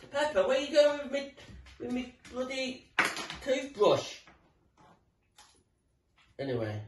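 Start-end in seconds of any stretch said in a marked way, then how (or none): 0.1-4.2 higher-pitched mild telling-off; calling after dog. Real, not acted. Owner to Pepper taking the toothbrush, calling out. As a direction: call out to a thieving pet.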